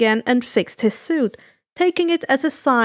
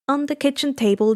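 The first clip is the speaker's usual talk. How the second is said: usual speaking voice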